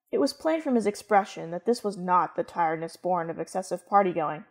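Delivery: ordinary delivery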